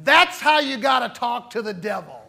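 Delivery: yelled out. Retold or imitated. Preacher retelling Wigglesworth's remark, half-yelled. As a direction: call it out across the bus stop. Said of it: "at the top of his voice, he yelled out".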